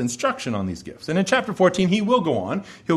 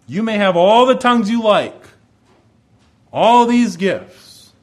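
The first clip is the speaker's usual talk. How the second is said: firm rebuke; 6 semitones higher, 6 dB louder than usual. Acted. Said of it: "Paul says to them".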